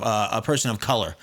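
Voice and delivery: ordinary talk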